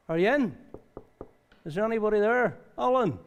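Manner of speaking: Jesus calling at the door. Acted. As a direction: call through the door, searching for someone inside. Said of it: "He may just say".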